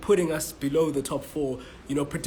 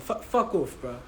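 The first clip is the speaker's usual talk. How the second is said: irritated; 3 semitones higher than usual. Real, not acted. Shooing away the interrupter.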